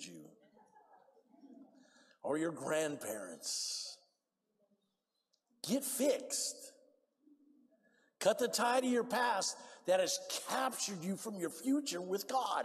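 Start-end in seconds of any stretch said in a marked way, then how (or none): none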